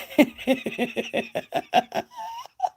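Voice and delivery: loud high-pitched laugh bursting out; burst of laughter. Real, not acted. Reacting to chat message.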